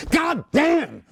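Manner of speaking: mock outburst. Von Steuben cursing at recruits, high shouted oath. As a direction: explode with the one English oath. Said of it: "Every other sentence would be like".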